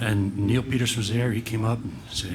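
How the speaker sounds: normal talking voice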